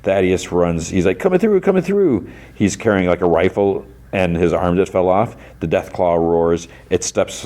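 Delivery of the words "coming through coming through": high-pitched hurried call-out. Retold or imitated. Thaddeus runs through the crowd, high mock call. Character calling out to clear way.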